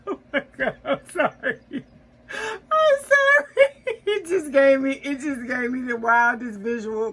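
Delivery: high, loud laughing apology, near tears; cracking up so hard the words squeak; real laughter breaking into every word; helpless laughter. Real, not acted. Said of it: "That just tickled the hell out of me".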